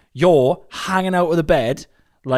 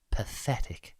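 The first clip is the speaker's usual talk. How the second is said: whispered; 3 semitones lower, 11 dB quieter, much slower than usual. Acted. Mock-judging remark, whispered.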